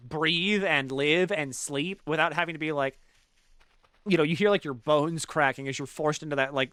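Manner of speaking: ordinary delivery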